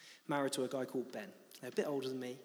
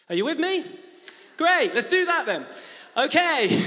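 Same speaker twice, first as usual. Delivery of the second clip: much louder and higher, rousing the room; rallying call; 11 semitones higher, 12 dB louder than usual. Real, not acted. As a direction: call out to the whole congregation. Rallying the congregation.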